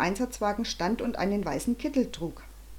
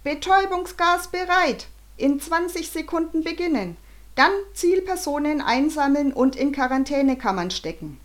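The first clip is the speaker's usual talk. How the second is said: high, forceful countdown orders; raised, carrying announcement voice; wailing megaphone voice; 6 semitones higher, 4 dB louder than usual. A narrator's reading. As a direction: howl it out like a loudhailer command. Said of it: "heulten die Megafonstimmen".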